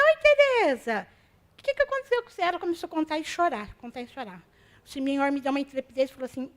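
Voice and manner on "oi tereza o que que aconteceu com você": higher alarmed concern to a friend; concerned greeting. Retold or imitated. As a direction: call out gently, dismayed. Greeting the interned seamstress.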